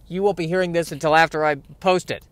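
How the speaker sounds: ordinary delivery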